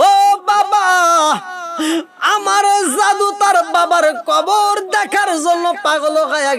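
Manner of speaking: high, loud chanted cry; weeping wail. Acted. Weeping mother pleads with father-in-law, high wail. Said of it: "কান্দে আর কয়".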